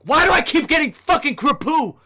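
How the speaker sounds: loud, high exasperated rant; fed-up gamer outburst; getting pissed. Real, not acted. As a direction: burst out in real frustration. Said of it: "I'm getting pissed".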